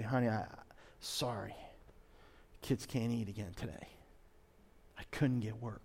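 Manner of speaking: a father apologising to his wife, barely audible; quiet, defeated confession to his wife; ashamed apology. Retold or imitated. Jobless father telling his wife.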